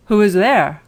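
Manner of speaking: put-on a big voice that frightens a child; big wolf voice. Wolf in grandmother's bed to the girl: loud, big wolf voice. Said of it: "the big voice of the wolf".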